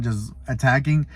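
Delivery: ordinary delivery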